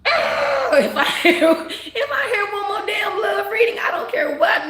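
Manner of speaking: high, loud exasperated yell with a laugh in it; fed-up outburst. Retold or imitated. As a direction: blow up laughing at yet another love reading. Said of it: "I was so freaking irritated".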